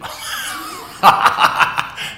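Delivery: loud burst of ha-ha laughter; teasing laugh. Real, not acted. Teacher just teasing a child, laughing loudly. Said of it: "I'm just teasing you".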